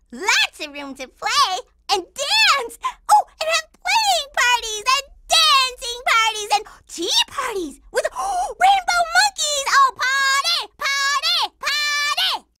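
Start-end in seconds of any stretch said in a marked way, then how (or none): 3.1-12.5 high, gasping, giddy build-up; carried-away excitement. Acted. Girl dreaming up parties in backyard, giddy high squeal. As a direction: get carried away, ending in a squealed chant.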